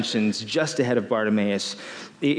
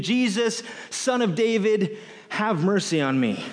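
mock desperate cry; 6 semitones higher than usual. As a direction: call out to Jesus over the crowd, lightly acted. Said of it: "So Bartimaeus is screaming out".

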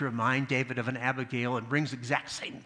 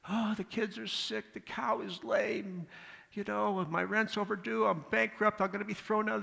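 complaining man; 7 semitones higher than usual. Retold or imitated. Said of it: "he says".

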